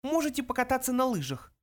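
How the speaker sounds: high, sarcastic mouse voice; snide. A narrator's reading. Mouse suggests skiing on the glaciers, snide. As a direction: offer it mockingly in a small high voice. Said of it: "язвительно предложил Бенджи".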